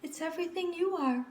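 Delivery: high, quick reassuring mother; comforting mother. A narrator's reading. Mother comforting her sad daughter, high and quick.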